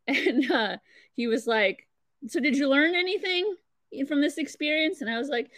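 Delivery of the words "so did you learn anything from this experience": higher-pitched quizzing parent, lightly acted; lightly voiced dad. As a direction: imitate a dad checking the lesson landed. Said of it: "at that time with my dad".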